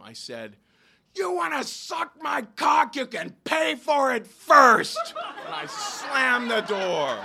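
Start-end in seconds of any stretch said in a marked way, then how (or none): 1.1-5.1 yelling a taunt at a departing lover; yelled taunt. Retold or imitated. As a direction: shout it to embarrass him in the street. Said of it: "I just yelled at him".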